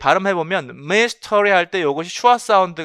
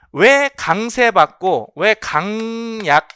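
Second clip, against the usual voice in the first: normal talking voice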